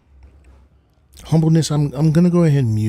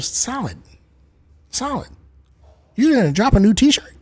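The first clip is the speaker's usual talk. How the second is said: much higher, mock-amazed fan voice; imagined excited viewer; 9 semitones higher than usual. Viewer asking streamer about the merch drop, mock high voice. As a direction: pipe up high, imitating a fan's question. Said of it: "you're probably saying to yourself".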